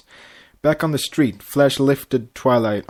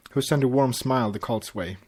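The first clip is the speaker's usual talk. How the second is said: ordinary delivery, nothing marked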